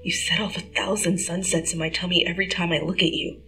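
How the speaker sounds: soft, tender; hushed tender whisper; softly. Acted. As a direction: reassure her gently. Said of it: "Peter smiled softly".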